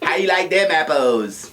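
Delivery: bad Boston accent, far off his own voice. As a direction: try a Boston accent and miss.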